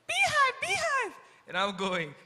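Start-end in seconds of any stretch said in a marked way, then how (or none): 0.1-1.1 very high, loud mock yell from afar. Mary running up to the disciples, high yelling. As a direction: cry it out as she runs up. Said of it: "Until Mary runs up yelling".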